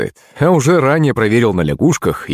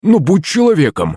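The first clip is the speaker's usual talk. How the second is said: higher-pitched, mild plea; begging; 5 semitones higher than usual. A narrator's reading. Frog begging Серёга for mercy, pleading. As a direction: implore with a raised pitch. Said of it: "взмолилась лягушка".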